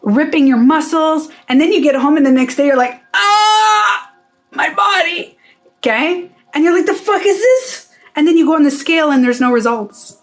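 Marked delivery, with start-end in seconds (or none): 3.1-5.4 high, drawn-out cry of soreness; mock pained wail. Gym newcomer waking sore next day, mock pained wail. Acting sore after boot camp. 6.9-7.9 high, incredulous question; mock disbelief. Sore gym newcomer questioning it, high mock disbelief.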